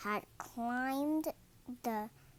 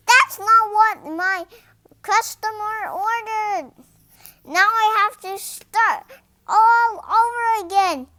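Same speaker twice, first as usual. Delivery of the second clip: loud, high, furious rant; angry scolding; 10 semitones higher, 16 dB louder than usual. A narrator's reading. Mister Herb scolds George over the wrong order, shouting. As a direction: scold him at full volume.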